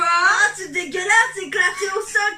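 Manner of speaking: disgusted outcry. Real, not acted. Reacting to the disgusting taste.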